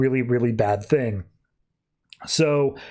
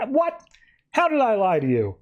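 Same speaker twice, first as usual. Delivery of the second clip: mock surprise; 8 semitones higher than usual. Villain feigning surprise.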